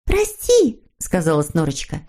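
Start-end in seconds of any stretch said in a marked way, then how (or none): 0.0-0.8 put-on very high, sweet girlish character voice; eager little girl figure. A narrator's reading. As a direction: agree gladly in a high little voice. Snork Maiden apologises in story. (тоненький девичий голосок)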